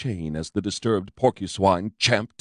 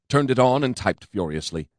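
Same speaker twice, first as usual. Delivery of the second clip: ordinary delivery, nothing marked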